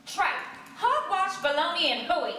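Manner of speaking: spat loudly. A narrator's reading. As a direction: spit out each word in scorn. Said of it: "spat loudly".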